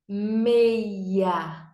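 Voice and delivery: Meia drawn out as "mmmei-ia"; stretched syllables. Shown as a demonstration. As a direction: stretch both parts of Meia slowly. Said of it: "Dois pedacinhos". (Meia esticado: "mmmei-ia")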